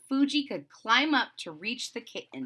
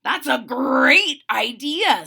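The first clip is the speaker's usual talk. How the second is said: loud, drawn-out enthusiasm; enthusiastic approval; 3 semitones higher, 7 dB louder, slower than usual. A narrator's reading. Spike approving Fuji's costume idea, enthusiastically. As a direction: stretch it out with big approval. Puppy cheers on friend's idea.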